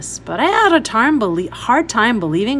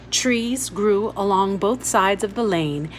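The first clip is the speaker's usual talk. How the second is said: ordinary delivery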